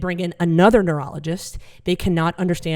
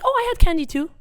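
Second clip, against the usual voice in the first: much higher and louder; high-pitched stoned dad; 12 semitones higher, 4 dB louder, faster than usual. Retold or imitated. Comic voicing stoned dad listing breakfast, high and chirpy. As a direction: chirp it innocently. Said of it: "she's like".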